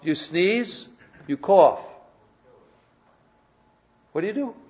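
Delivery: ordinary delivery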